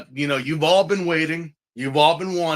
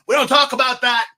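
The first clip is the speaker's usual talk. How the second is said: high, loud shut-down; louder dismissive retort; 9 semitones higher, 4 dB louder, faster than usual. Retold or imitated. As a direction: cut them off firmly. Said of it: "I'm like".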